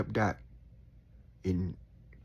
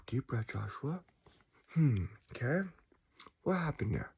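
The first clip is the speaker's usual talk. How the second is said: softer, soothing inner voice; calm self-talk; 6 dB quieter than usual. Retold or imitated. Speaker calming himself when grumpy, soft and soothing. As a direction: talk yourself down gently.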